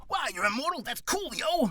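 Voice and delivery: high-pitched gushing fan; squeaky, wowed onlooker; excited bystander. Acted. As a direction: gush like it's the coolest thing ever. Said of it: "the person who says".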